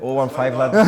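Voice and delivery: laughing echo in fake Scouse; mock Scouse. Friend echoing the Liverpool lad's area code, mock Scouse. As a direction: parrot a Scouser, badly. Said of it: "He's got the worst Scouse accent".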